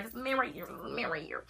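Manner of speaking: hushed, unvoiced whisper; frightened hushed whisper. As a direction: whisper it so he can't hear. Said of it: "whispering warning to mom by text".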